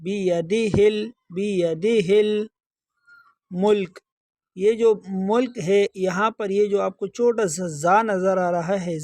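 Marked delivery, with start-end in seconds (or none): 0.0-4.0 بیدہ الملک slowly, linked as "yadihil-mulk"; joined ha and lam. Shown as a demonstration. Teacher showing the silent alif, slow linked "yadihil". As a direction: chant بیدہ with h running straight into l. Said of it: "ہا کو direct لام کے ساتھ ملائیں گے".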